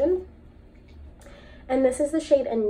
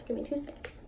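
whispered aside; 11 dB quieter, faster than usual. Real, not acted. Aside off mic, unvoiced.

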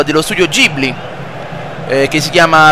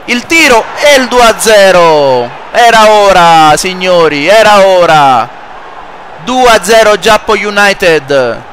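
goal celebration; 4 semitones higher, slower than usual. Acted. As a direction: roar the goal, savour every word. Second goal in commentary.

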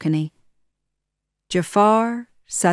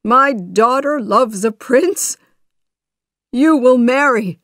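louder, higher, joyful father; happy proclamation; 6 semitones higher, 6 dB louder than usual. A narrator's reading. Sultan blesses his daughter's marriage, happily proclaiming. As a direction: announce it with delight. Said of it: "he said happily".

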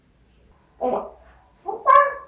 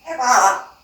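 usual speaking voice

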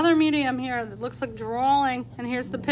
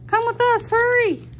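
much higher and louder, hurried call; urgent pig voice; 12 semitones higher, 7 dB louder than usual. Acted. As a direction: urge them along, breathless with haste. Pig urges the dragon to flee.

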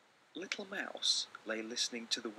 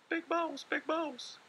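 much higher, louder, slow little voice; calling little mouse; 6 dB louder, slower than usual. Acted. Little Mouse calling his brother at night, high squeaky call. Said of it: "he cried".

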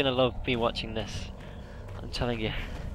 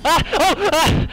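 terrified scream; 13 semitones higher, 9 dB louder, slower than usual. Real, not acted. Jump scare by creature.

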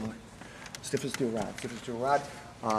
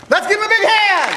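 high, loud call for applause; rally the crowd with a shout; shouting announcer; 12 dB louder than usual. Real, not acted. Calling audience to applaud.

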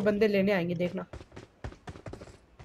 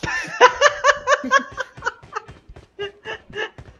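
high laugh; 14 semitones higher than usual. Real, not acted. Laughing at the pad mixup.

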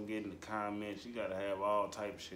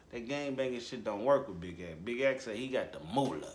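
ordinary talk

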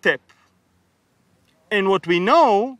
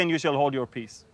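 softer, lower quote of the line; quiet repeat; 5 semitones lower, 7 dB quieter than usual. Shown as a demonstration. Preacher quietly repeating Moses's words. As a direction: drop it down to explain. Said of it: "Why did he say".

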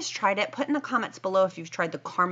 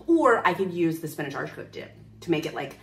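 ordinary delivery

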